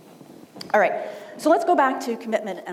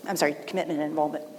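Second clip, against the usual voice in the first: ordinary talk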